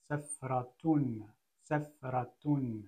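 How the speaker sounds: safratun drawn out very slowly; teacher sounding out safratun slowly; slow blending of syllables. Shown as a demonstration. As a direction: stretch safratun out for the learner. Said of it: "Donc si je lis".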